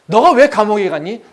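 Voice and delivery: loud, high rhetorical question; forceful questioning; pointed challenge. Acted. God questions the prisoner pointedly.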